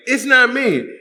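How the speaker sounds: high, squeaky protest imitating Janet; high mock innocence. Comedian voicing a girl denying the noises, high and innocent. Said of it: "And Janet's like".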